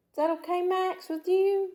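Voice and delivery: high, gentle pet-talk voice; sweet-talking the dog, asking if he minds. Real, not acted. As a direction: coo the question to the dog.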